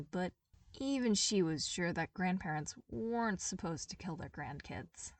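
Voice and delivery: ordinary delivery, nothing marked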